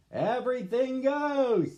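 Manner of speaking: high, loud, slow proclamation; Papa's hearty big call; booming declaration. Acted. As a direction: announce it grandly to the movers. Papa declares to the movers.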